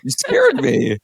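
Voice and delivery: very high mock-frightened squeal; hammed-up fright, pitch shooting up; startled. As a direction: act jolted out of your seat. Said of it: "like, is jolted".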